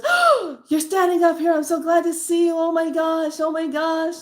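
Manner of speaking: gushing, high-pitched joy at seeing the reader; gushing client. Retold or imitated. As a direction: squeal it like an overjoyed returning client. Grateful client gushing on return.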